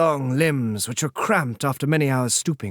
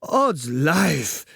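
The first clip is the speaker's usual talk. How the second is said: foppish oath; 2 semitones higher than usual. Acted. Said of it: "he said".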